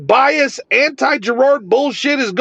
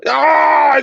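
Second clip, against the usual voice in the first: frustrated yell of "ah"; exasperated cry; 4 semitones higher than usual. Real, not acted. Creator fed up with his critics, exasperated cry. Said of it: "Anyway, I'm fired up".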